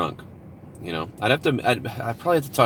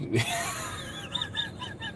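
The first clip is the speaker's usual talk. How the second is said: high laughter; 12 dB quieter than usual. Real, not acted. Wild idea cracks him up.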